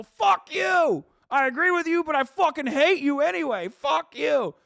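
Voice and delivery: yelled high and loud, imitating angry chatters; mock angry shout. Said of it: "they come in here and go".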